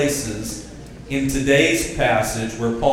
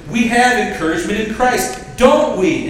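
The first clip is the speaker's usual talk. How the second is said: higher, louder rousing preaching; preacher's call for amen; 7 semitones higher, 5 dB louder than usual. Shown as a demonstration. Preacher drawing an amen from church, loud and high. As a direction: pump it up for the congregation to answer. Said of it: "a preacher like Paul could say it like this".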